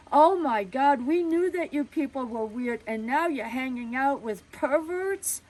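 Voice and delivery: loud, high scandalized gasp of words; a prude aghast at the news; outraged shock. Retold or imitated. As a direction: neighbor reacting with horror to the father.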